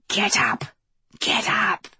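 urgent hissed whisper; hissing. Acted. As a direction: hiss it at the fainted boy. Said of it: "hissing commands".

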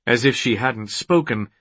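usual speaking voice

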